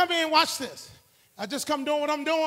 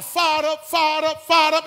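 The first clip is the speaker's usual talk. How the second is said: rising shout, each fired up louder; fired-up holler; 2 semitones higher, 5 dB louder than usual. Pretend. Preacher hollering fired up to the congregation, building shout. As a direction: holler it to pump up the church. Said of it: "if I come in here, holler".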